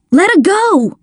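high, urgent plea; alarmed cry. A narrator's reading. Boy begging his father to release her, alarmed. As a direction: cry out to make him stop.

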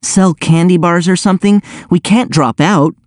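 high, urgent appeal; pleading. A narrator's reading. Chloe urging Oz not to drop out, pleading. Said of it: "Chloe pleaded".

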